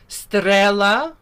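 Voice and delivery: Strela with a rolled R. Shown as a demonstration. Teacher models Strela, rolling the R loudly. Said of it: "we're gonna roll our R".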